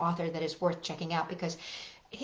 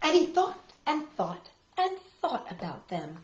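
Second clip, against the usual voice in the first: put-on gentle read-aloud for small children; picture-book narration for little listeners; storybook reading; 2 semitones higher, 4 dB louder than usual. A narrator's reading.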